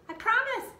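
put-on high, eager girl's promise; an eager young girl brushing off her brother; Annie's voice. A narrator's reading. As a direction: toss it off high and quick like an impatient kid.

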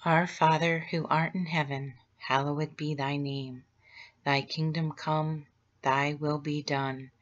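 normal talking voice

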